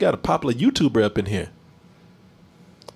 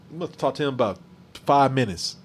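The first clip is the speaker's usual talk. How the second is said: ordinary talk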